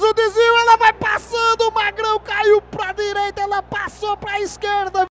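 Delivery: high, urgent play call; excited commentary. Real, not acted. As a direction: raise it as the ball flies by. Near miss on goal.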